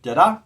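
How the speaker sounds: a single da-DA, rising and loud; one iamb. Shown as a demonstration. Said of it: "iambic monometer".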